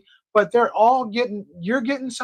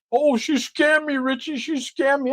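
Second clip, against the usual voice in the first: mock whiny complaint; 6 semitones higher, slower than usual. Mocking whiny foreign guys.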